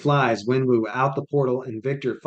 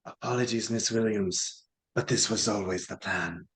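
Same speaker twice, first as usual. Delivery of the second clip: soft, low cold villain; dark lord; 3 semitones lower, 6 dB quieter than usual. Acted. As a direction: quietly savour the betrayal. Villain betrays Riri.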